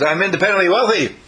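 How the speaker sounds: bold declaration. Pretend. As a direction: announce it proudly. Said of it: "Jason says".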